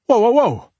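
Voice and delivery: high, loud burst of protest; startled, defensive exclamation; alarmed protest. Acted. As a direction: cut in sharply to halt the questioning. Husband protests the detective's pressure.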